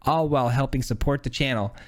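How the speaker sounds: upbeat promo read. Acted. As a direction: pitch the merch like an ad. Channel shop plug before video.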